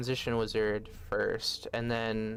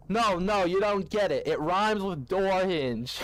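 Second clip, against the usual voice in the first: a hammed-up Brooklyn rapper pitching a deal; mock Brooklyn accent; 7 semitones higher, 5 dB louder than usual. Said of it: "gave [name] the worst Brooklyn accent possible".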